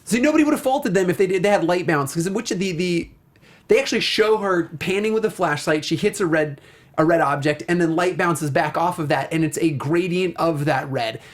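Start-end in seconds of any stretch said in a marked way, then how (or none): none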